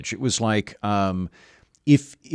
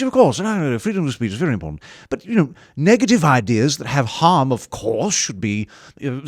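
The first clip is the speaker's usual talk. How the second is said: put-on British accent, swooping pitch; 3 semitones higher than usual. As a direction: imitate a British friend explaining his position. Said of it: "when people do a British accent ... You're pretty good".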